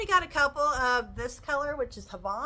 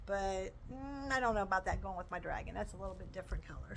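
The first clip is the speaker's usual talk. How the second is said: ordinary delivery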